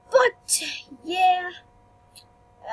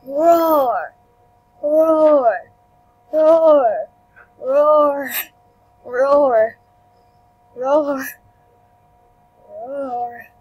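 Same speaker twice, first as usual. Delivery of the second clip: loud, long pretend roar, falling away; mock roaring; 5 dB louder, much slower than usual. Player roaring over and over in the game, mock monster roar. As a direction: roar it out slowly, one after another.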